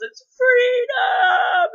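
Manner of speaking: very high, drawn-out shout; loud, stretched, wailing movie yell; imitated dying yell. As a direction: bellow a hammed-up final battle cry. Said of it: "the last thing he yells".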